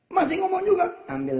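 high, loud mock outburst; fed-up wife flaring up; exasperated outburst. Nagged wife bursting out at husband, high and loud.

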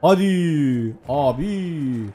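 very slow, stretched vowels; drawn-out urging. Real, not acted. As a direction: drag out each word as a long plea. Pleading while playing.

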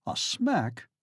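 startled, breathless question; shocked echo of her words; gasped. Acted. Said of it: "gasps the young Duke".

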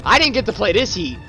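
ordinary talk

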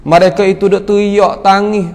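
ordinary talk, nothing marked

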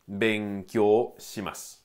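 Benkyou said slowly as ben-kyou, shimasu with a silent u; slow model pronunciation. Shown as a demonstration. Teacher models Benkyou shimasu slowly for listeners. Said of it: "esto se lee ben y kyo".

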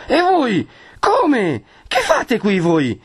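high, drawn-out disbelieving questions; astonished questioning. A narrator's reading. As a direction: pitch it up in astonishment, stretching the words. Perpetua astonished to meet the couple. (domande stupite, acute e allungate)

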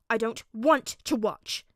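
loud, slow, petulant refusal; fuming. A narrator's reading. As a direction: stamp out each word angrily. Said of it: "Bakugo fumed".

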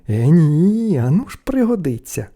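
stubborn little brother; boyish refusal. A narrator's reading. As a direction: cheerfully dig your heels in. Boy refuses his brothers.

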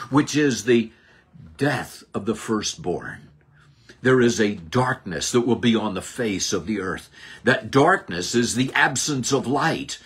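ordinary delivery

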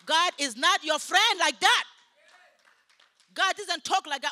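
loud, high pulpit declaration; emphatic rebuke. Real, not acted. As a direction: thunder it at the congregation. Preacher correcting false claims.

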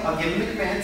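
normal talking voice

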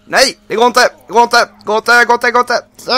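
desperate, high and loud, "it won't work!"; panicked yelling. Real, not acted. Gamer trapped mid-escape, panicked yelling. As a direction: panic rising as the escape fails.

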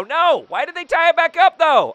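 high, loud protest; loud, high complaint; dismayed yell. Real, not acted. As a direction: cry out in frustrated disbelief. Dismayed at tied game.

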